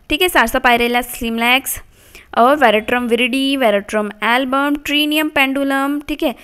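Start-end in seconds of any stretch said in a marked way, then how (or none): none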